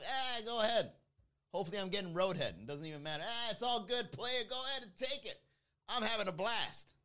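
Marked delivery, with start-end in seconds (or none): 0.0-0.9 high-pitched, easygoing wave-through; breezy yielding. Acted. Waving through a driver cutting in. 3.3-6.9 high-pitched, hammed-up easygoing driver; carefree yielding. Cheerfully yielding to driver cutting in.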